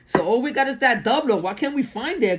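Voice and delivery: ordinary delivery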